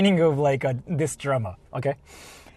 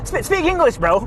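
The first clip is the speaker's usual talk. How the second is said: mocking entitled bro; 10 semitones higher, 3 dB louder than usual. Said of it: "the mindset of, like, you know".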